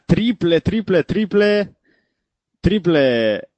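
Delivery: rising, drawn-out cry of triple; excited call. Real, not acted. Three-pointer called in the commentary. (grito emocionado de triple)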